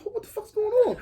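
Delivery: high, half-laughing disbelief; incredulous outburst. Retold or imitated. Turning round, security pointing at him.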